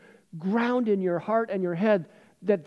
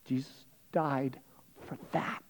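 much softer and slowed, fading to a breath on for that; slow, softer repeat; 2 semitones lower, 8 dB quieter, slower than usual. Shown as a demonstration. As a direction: let it sink in, slow and hushed. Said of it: "You know, Jesus died for that".

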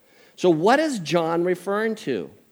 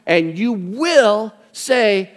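slow, higher-pitched mock ultimatum; stern mother; 6 semitones higher, 4 dB louder, much slower than usual. Mother ordering her toddler to obey, slow mock-stern warning. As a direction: lay down the law word by word. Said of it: "Katie goes".

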